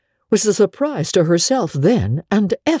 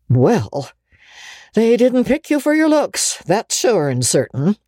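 emphatic gossip; 6 semitones higher than usual. A narrator's reading. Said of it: "was Missus Rachel Lynde's emphatic comment".

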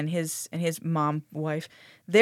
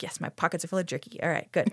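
quiet smug mutter; 2 semitones lower, 8 dB quieter than usual. Retold or imitated. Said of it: "Garrett's like".

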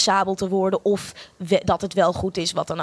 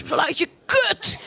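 much higher, slow, hammed-up bellow; mock drama teacher yell; 9 semitones higher than usual. Imitating the drama school teachers yelling at students. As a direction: ham up the shouting acting coach. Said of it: "vier jaar lang tegen je geschreeuwd".

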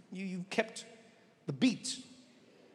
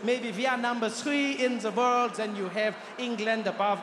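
ordinary talk

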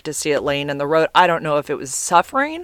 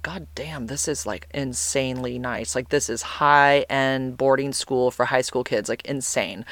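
soft, slow, hushed amazement; awed under breath; 3 semitones lower, 6 dB quieter than usual. Retold or imitated. Looking around the fancy boarding school grounds, hushed awe. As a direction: murmur it so no one hears. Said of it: "I'm like".

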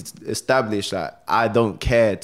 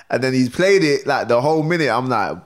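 ordinary delivery

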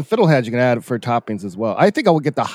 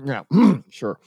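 ordinary talk, nothing marked

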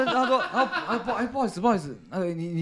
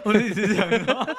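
normal talking voice

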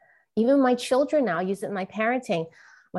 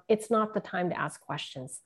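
ordinary delivery

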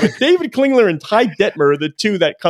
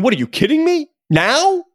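higher, mock-incredulous commissioner; pitched-up mock disbelief, hammed for laughs; incredulous Larry Scott; 9 semitones higher than usual. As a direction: play the stunned league boss who can't believe it. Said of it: "Larry Scott must be sitting there going".

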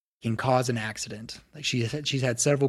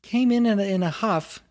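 higher, light gnome voice, lowered to a hush; 9 semitones higher, 3 dB louder than usual. Acted. Gnome telling the visitor about her master's mood, high voice. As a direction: keep the gnome's high voice, a bit confidential.

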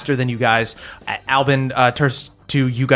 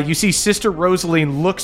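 ordinary talk, nothing marked

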